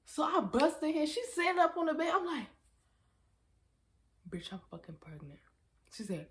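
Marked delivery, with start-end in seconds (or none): 4.3-5.4 hushed whisper of a secret. Retold or imitated. Whispering the news to her friend at three in the morning. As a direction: whisper it so no one wakes.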